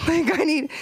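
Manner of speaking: usual speaking voice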